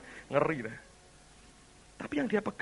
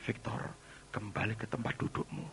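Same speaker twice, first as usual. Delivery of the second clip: low, soft voice of God; quiet instruction; 4 semitones lower, 6 dB quieter than usual. Acted. God sending Victor back to his seat, quiet instruction. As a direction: give the order gently and calmly.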